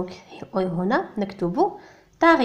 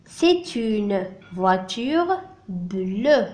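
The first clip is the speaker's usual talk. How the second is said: extremely slow "C'est une voiture bleue"; drawn-out teacher demo; very slow model answer; much slower than usual. Shown as a demonstration. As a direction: spell out the answer word by word. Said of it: "Elle est de quelle couleur".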